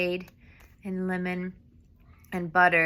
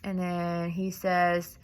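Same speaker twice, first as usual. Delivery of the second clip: ordinary talk, nothing marked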